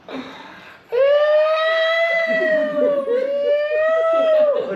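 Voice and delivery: put-on long high wailing baby cry; imitated baby wail. Baby fallen from sofa, long high wail. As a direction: wail like a toddler who fell. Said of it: "Real cry".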